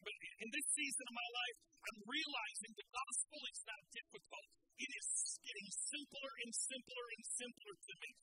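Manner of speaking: loud, driving sermon delivery; full-force preaching. Acted. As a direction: preach it hard to the crowd. Preaching to congregation.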